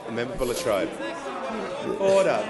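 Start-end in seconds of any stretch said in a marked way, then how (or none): 2.0-2.5 loud firm call for silence; shouting order. Real, not acted. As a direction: cut through the uproar. Speaker calls chamber to order.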